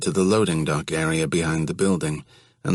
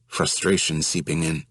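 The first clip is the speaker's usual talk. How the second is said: ordinary delivery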